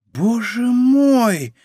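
high, drawn-out astonished gasp of words; hushed wonder, but voiced; awed murmur. A narrator's reading. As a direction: stretch it slowly, high, in amazement. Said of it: "прошептал Планше".

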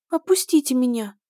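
high, thin little plea; weak squeak. Acted. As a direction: squeak it out timidly. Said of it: "слабо пропищала рыжая принцесса". (слабый писк)

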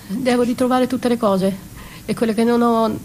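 ordinary delivery

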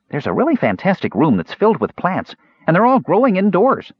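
high, excited little voice; enthusiastic kender. A narrator's reading. Kender enthuses about a room.

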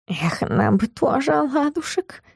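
soft, slow, wistful hiss; hushed cat voice, drawn out. A narrator's reading. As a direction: hiss it longingly under the breath. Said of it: "прошипел кот".

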